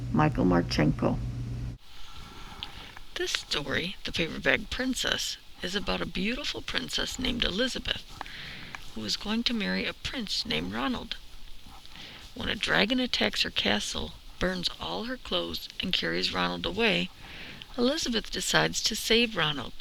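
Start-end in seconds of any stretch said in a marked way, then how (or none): none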